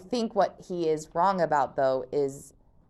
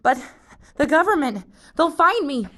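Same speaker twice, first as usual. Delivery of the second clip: higher, louder burst of panic; blurting out his fear; panicky Luke; 11 semitones higher, 10 dB louder than usual. Acted. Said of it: "Luke fought down panic".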